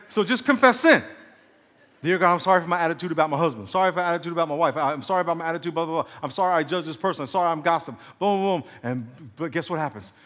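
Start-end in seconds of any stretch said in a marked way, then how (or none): 2.0-8.0 fast, repetitive mock prayer; rattled-off confession. Sinner confessing to God at a stoplight, rattled off. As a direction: reel off apologies like a list.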